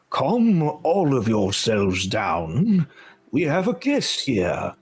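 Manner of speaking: slow, sweeping unicorn voice with big pitch swings; regal unicorn. Acted. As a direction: draw it out like a grand talking unicorn. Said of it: "then you see a unicorn".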